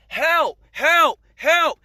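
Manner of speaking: loud imitated cries for help; agonized screaming. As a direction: cry out as if in agony. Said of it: "screaming, crying out of agony".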